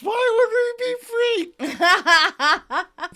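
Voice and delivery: very high, very slow, laughing puzzlement; Frank, happily confused, to Phoebe. Retold or imitated. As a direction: stretch it out, cheerfully lost. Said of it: "Frank is happily confused".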